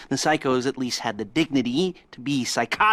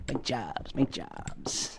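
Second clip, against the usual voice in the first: soft, laughing, savoring repeat; gleeful murmur; 8 dB quieter, much slower than usual. Acted. Lester gloats softly over the bank jobs, laughing. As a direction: whisper the words to yourself with relish.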